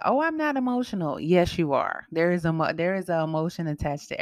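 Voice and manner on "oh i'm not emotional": high, fast mocking quote; mock dismissive voice; mock denial. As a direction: imitate someone brushing it off. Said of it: "people like to".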